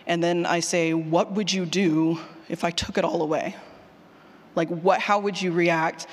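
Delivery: usual speaking voice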